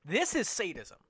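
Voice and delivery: usual speaking voice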